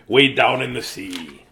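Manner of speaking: plain reading voice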